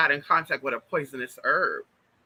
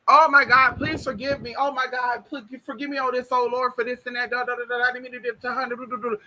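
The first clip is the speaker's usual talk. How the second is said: imitated screamed confession; 7 semitones higher than usual. Man waking up begging forgiveness, imitated screaming. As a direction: burst out high, tail off into pleading babble. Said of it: "he started screaming".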